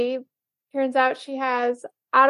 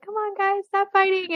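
lightly acted plea, pitched well above normal; pleading peacemaker; 7 semitones higher than usual. Al pleads with his bickering friends, high mock plea.

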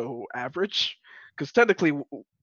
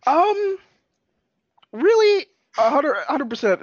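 high, loud, excited burst; 12 semitones higher, 10 dB louder than usual. Real, not acted. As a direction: jump in much higher and louder, thrilled. Excited answer about the butt.

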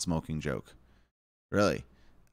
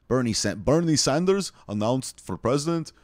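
raised, louder mock announcer; hammed-up, higher headline read; put-on headline voice; 5 semitones higher, 4 dB louder than usual.

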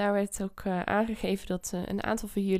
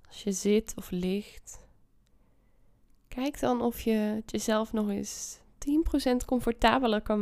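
guided meditation. Acted. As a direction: ease them into calm. Leads a meditation.

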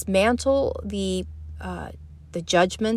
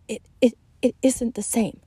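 stammering the first word; mildly flustered stammer; sputtering; 3 semitones higher than usual. Acted. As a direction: trip over it, flustered. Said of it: "Caroline sputtered".

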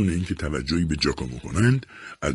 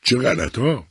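higher, cheeky retort; playful comeback, pitched up; wife shouting back playfully, higher; 7 semitones higher, slower than usual. A narrator's reading. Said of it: "سیمونا فریاد زد".

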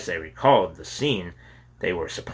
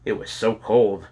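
ordinary delivery

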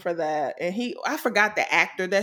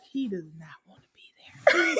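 hushed whisper, lower; 5 semitones lower, 5 dB quieter than usual. Retold or imitated. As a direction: whisper it like a secret. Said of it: "I was like".

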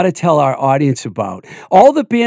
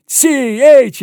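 announcer call; 10 semitones higher than usual. Retold or imitated. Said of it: "and he went".

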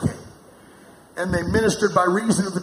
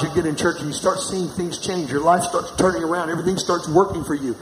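normal talking voice

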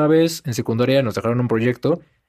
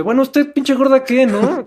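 higher, cheeky mock retort; imagined rude comeback; 10 semitones higher, 3 dB louder, slower than usual. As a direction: fire back a pretend insult, half joking. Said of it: "imagínate tú contestarle así".

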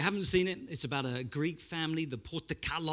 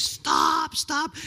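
high, loud mock cry of protest; protesting stop; 11 semitones higher, 6 dB louder than usual. Auntie protests as the dad sprays her arm, high mock yelp. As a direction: yelp it like she is being sprayed.

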